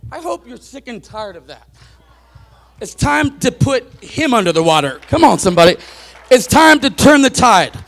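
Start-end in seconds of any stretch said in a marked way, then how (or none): 2.8-7.8 louder, driving the point home to the congregation; full-force preaching. Acted. Preacher warning the congregation about unsteady leaders, loud preaching. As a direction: preach it hard from the pulpit.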